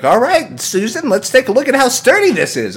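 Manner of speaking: high-pitched confident pitchman; cocky host. Retold or imitated. Imitating the ladder host to co-host Susan, mock confident. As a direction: announce it with showman swagger. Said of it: "to be like".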